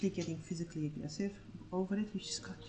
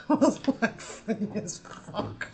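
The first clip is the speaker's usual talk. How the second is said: slow, louder words through laughter; laughing; 4 semitones higher, 8 dB louder, much slower than usual. Real, not acted. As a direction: laugh as you say it. Laughing at the memory.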